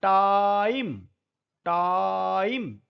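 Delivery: टाइम drawn out slowly with a long ai; slow vowel demo. Shown as a demonstration. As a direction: stretch टाइम so the ai rings out. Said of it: "इसको बोलते हैं आई". (टाइम को धीरे खींचकर आई बोलना)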